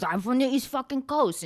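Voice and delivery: light Boston accent impression. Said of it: "that's how they talk".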